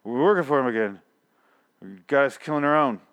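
mock slurred blurt; Maggie. Repeating what the actress blurts out, slurred imitation. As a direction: run the words together sloppily. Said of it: "she's kinda slurring".

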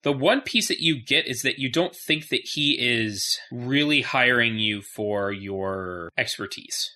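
ordinary talk

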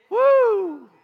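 high, loud joyful whoop; preacher whooping over a big offering; excited whoop. Real, not acted. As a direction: let out a big celebratory woo. Celebrating the hundred thousand gift.

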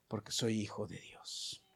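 whisper. Acted. Whispered affirmation of faith.

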